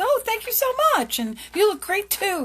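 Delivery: high, gushing, flattered; light up at the compliment; delighted thanks. Retold or imitated. Person thanking for a compliment, gushing and high. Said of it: "people are gonna go".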